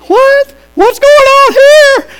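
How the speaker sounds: very high, loud, slow shocked cry; hammed-up gasp of shock; startled couple. Little couple in the second row, shrieking high and loud in shock. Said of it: "this little couple was like".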